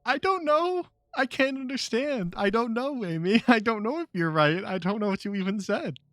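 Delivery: high, confused protest; baffled whine. Real, not acted. Baffled by cryptic answers.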